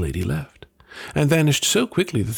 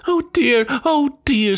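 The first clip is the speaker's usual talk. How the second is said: much higher, louder wailing; sobbing wail; 4 dB louder, much slower than usual. Acted. Wife wailing with a sausage on her nose, sobbing. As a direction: wail in distress. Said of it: "sobbed the woman".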